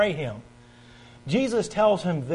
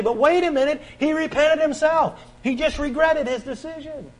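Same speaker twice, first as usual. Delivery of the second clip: objector's protest; 8 semitones higher than usual. Pretend. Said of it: "And you say".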